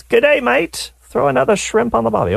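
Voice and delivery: put-on high-pitched, goofy Australian accent; Jim Carrey's mock Australian accent. Radio host quoting Dumb and Dumber, high hammed-up Australian accent. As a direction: put on a silly, squeaky Aussie twang. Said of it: "where the Jim Carrey character".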